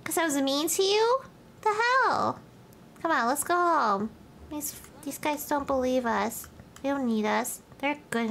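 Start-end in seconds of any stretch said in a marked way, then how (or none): 0.0-2.4 raised, disbelieving complaint; high incredulous protest. Acted. Streamer baffled at another flirting sim, high incredulous. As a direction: sound baffled and put out.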